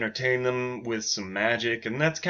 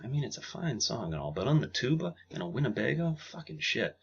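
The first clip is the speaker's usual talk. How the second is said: much softer muttered gripe; under her breath; 7 dB quieter than usual. Acted. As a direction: grumble it half to yourself. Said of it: "She says it under her breath".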